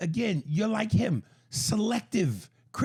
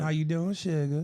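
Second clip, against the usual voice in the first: put-on Southern accent. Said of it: "that Southern accent".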